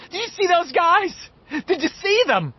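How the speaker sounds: high, excited squeal; thrilled. A narrator's reading. Beverly to the boys after escaping, thrilled. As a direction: burst out, amazed and delighted.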